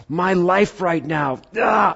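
slow, high, hammed-up frustrated sigh; exasperated groan. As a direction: drag it out like you're fed up with life. Imitating a frustrated listener.

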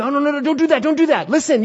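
high, loud frantic warning; urgent pleading. Acted. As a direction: plead to stop someone from a mistake, pitch shooting up. Acted plea to a loved one.

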